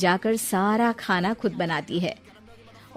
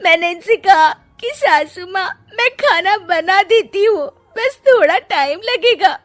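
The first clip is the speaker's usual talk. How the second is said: higher, louder whiny false tears; fake crying complaint; 10 semitones higher, 7 dB louder than usual. Daughter-in-law faking tears to her husband, high and whiny. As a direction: play the victim to win him over. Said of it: "रोने का नाटक करने लगती है".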